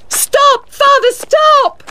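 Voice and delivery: very high, desperate shout; screamed. A narrator's reading. Daughter stops her father beating a man, screaming. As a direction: scream to halt him, drawing it out. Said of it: "Maggie screamed".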